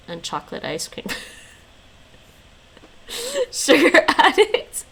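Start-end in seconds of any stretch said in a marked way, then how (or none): 3.5-4.8 mock teasing. Teasing friend about her dessert.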